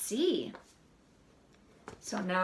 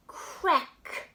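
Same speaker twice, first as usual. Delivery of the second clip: usual speaking voice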